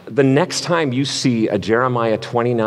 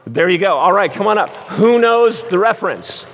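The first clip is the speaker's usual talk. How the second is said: game host; 9 semitones higher, 4 dB louder than usual. Real, not acted. Hosting a Bible drill game.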